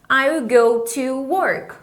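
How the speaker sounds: "I will go to work" spoken slowly, stress on "work"; unhurried teacher model; slow model sentence. Shown as a demonstration. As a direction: model it for students to copy.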